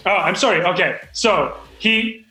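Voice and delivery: ordinary talk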